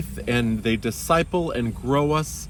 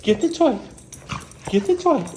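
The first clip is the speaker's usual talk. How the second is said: squeaky dog urging; 12 semitones higher, faster than usual. Real, not acted.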